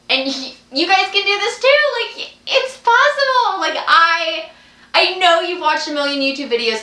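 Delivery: rally the viewers with bright energy; excited encouragement. Real, not acted. Cheering on her viewers.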